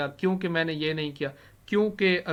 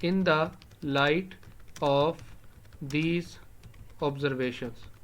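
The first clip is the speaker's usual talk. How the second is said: word by word, pausing after each; spaced-out teacher's dictation; dictating; much slower than usual. Shown as a demonstration. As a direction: dictate it to a class piece by piece. Dictating the translated phrase.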